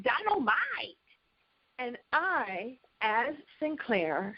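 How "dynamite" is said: high stretched sitcom shout of Dynamite; Jimmy Walker's Dynamite. Retold or imitated. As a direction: belt it out like the seventies sitcom star. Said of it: "Jimmy Walker on Good Times had".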